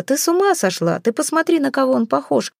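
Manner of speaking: high-pitched exasperated reproach; heated outburst. A narrator's reading. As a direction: voice climbing well up in disbelief. Acted quarrel, jealous outburst.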